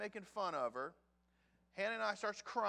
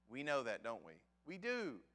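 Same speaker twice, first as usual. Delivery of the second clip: ordinary talk, nothing marked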